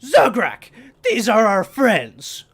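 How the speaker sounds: loud, high character voice; NPC voice. Acted. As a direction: boom it out as the leader.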